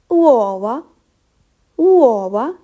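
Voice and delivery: uova said OO-oh-vah, clear open vowels; Italian word model. Shown as a demonstration. Teacher models the Italian word for learners. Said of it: "Focus on the pronunciation".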